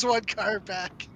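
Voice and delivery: gruff, slow dwarf barkeep; slow and curt, pointing the way; right barkeep voice. Acted. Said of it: "that's the wrong voice".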